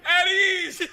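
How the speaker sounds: high, loud laughing shout; retold yell. Recruit calls the bay to attention, loud high yell. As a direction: bark the call out mid-laugh. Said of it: "So I jump up and yell".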